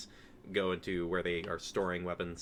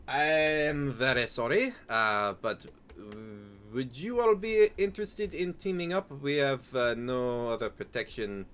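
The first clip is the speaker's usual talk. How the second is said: lightly voiced polite stranger, higher pitched; apologetic stranger; 4 semitones higher, 3 dB louder than usual. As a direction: make a hesitant, courteous request in a lightly raised voice. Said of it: "There's a pair of individuals".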